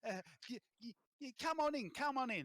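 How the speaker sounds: put-on high, stammering weird accent; stammer, then call them in, loud and high; flustered oddball host with a put-on accent; Les. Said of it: "I'm talking in a weird accent".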